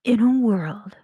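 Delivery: loud, husky whispered trailer intro; movie trailer voice. Shown as a demonstration. Trying a trailer line into the mic, loud breathy announcer. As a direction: rasp it big and close to the mic.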